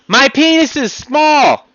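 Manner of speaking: high, loud, slow shout; honest yell. Shown as a demonstration. Showing that honest people can yell, shouting. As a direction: belt out the truth proudly. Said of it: "I can yell there".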